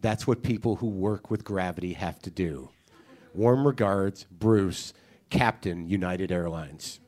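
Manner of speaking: low, measured, solemn reading; email read aloud. Acted. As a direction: read it like earnest poetry, letting each phrase land. Reads a listener's poetic email aloud.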